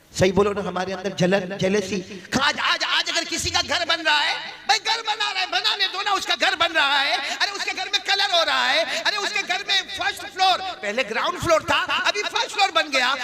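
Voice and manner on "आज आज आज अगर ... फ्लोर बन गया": very high, mock-envious rant; full-force preaching. Preacher mimicking envy of a neighbour's house, impassioned. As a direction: act out the jealous neighbour's fuss.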